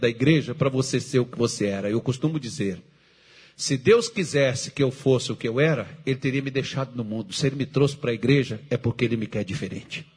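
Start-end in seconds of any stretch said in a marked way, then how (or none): none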